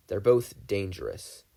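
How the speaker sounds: ordinary delivery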